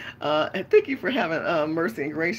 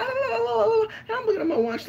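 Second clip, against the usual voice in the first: exaggerated impatience; 3 dB louder, slower than usual. Real, not acted. Impatient in line.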